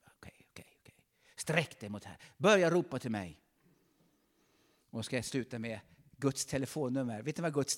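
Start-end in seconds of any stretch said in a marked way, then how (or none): 0.1-0.9 breathy hushed whisper into the mic. Acted. Preacher whispers a word to the congregation, hushed. 2.4-3.4 louder, falling call to cry out. Acted. Preacher urges church to cry out, loud. Preacher calling out God's word.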